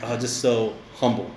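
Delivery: low, soft, slow and awed; awed sigh. Retold or imitated. Pastor marvelling at humble brothers, soft and awed. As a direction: marvel quietly at their humility. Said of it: "I'm like".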